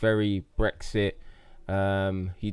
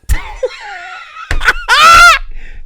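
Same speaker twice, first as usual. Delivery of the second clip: loud, high shrieking laugh; loud burst of laughter; 17 dB louder than usual. Real, not acted. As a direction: cackle loudly at your own joke. Bursts out laughing at own joke.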